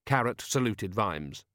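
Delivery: usual speaking voice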